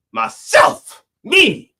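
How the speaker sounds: sudden high squeak. Acted. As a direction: jump way up on it. Exasperated about myself.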